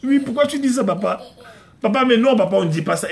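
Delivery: high, loud mock-child protest; imitated daughter. Daughter questioning papa's bad word, imitated indignant child. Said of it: "c'est ma fille, ça".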